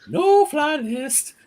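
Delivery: high, slow, drawn-out mock announcement; high slow mocking quote; acted quote. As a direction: stretch it out high and loud like a smug official. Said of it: "I just go".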